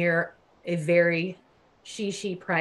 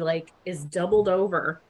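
ordinary delivery

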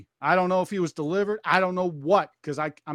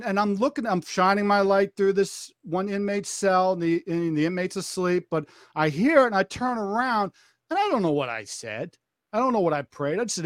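normal talking voice

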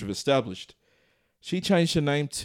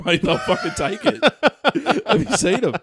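talking through laughter, higher; laughing; 10 semitones higher, 6 dB louder than usual. Real, not acted. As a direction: giggle out the words. Laughing through his own joke.

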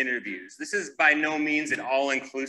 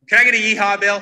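excited call; 6 semitones higher, 8 dB louder, faster than usual. Real, not acted. Rallying a conference crowd.

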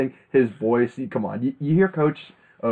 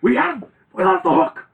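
much higher, louder yelling; furious coach rant; 5 dB louder than usual. Retold or imitated. Host reenacts the coach's furious postgame rant, high and loud. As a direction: rage at the podium. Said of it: "He was pissed".

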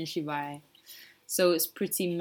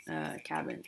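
whispered aside; 9 dB quieter than usual. Real, not acted.

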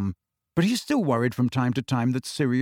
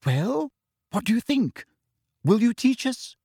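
a much higher eager schoolgirl asking; Hermione, hopeful; 10 semitones higher than usual. A narrator's reading. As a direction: press him with an eager plea. Hermione asks Harry.

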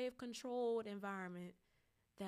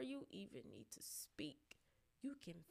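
breathy whispered aside; 3 semitones lower, 10 dB quieter than usual. Real, not acted. Speaker to viewers about intuition, whispered. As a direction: drop to a hushed whisper to make the point.

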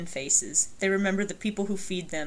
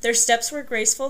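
ordinary talk, nothing marked

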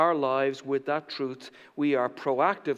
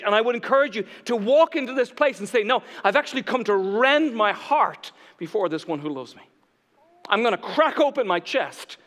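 loud, fervent declaration easing slightly toward the end; raised, driving preacher's voice; impassioned preaching; 5 semitones higher, 6 dB louder than usual. Real, not acted. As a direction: declare total devotion to the room. Preaches at full force.

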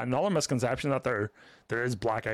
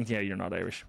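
dismissive; 7 semitones lower, 5 dB quieter than usual. Retold or imitated.

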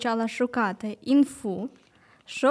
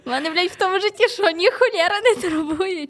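mock indignation; 5 semitones higher, 3 dB louder than usual. Mocking her own empty list.

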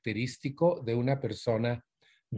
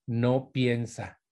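usual speaking voice

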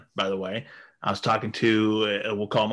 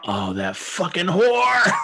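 slow, higher-pitched dawning fury; mock outrage; 8 semitones higher, 4 dB louder, much slower than usual. Husband realizing his wife set him up, mock outrage. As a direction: build to a hammed-up yell.

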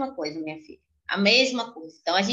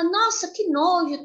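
put-on disgusted child; 7 semitones higher, slower than usual.

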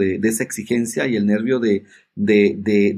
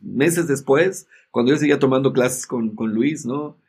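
ordinary delivery

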